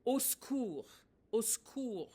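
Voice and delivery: "au secours" run together fast as /o sku/; the way French people actually say it; smushed French. Shown as a demonstration.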